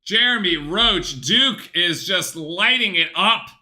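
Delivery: loud, high yelling at the TV; excited shouting. Real, not acted. Fan roaring at the TV as Roach drills a three, shouting. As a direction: cheer the shot like you're on your feet. Cheering a Duke three-pointer.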